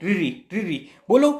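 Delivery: "रिरी" with a rolled r and the tongue curled back; teacher showing "रिरी" as a retroflex sound; ri sound demo. Shown as a demonstration. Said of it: "बोल के देखो रिरी".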